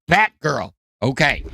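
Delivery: ordinary delivery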